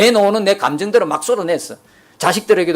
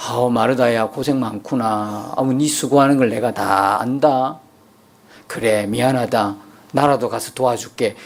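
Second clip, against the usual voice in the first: lower, slow and soothing; mock comforting; 8 semitones lower than usual. Imagined Jesus consoling busy Martha, tender. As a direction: pat her on the back with your voice.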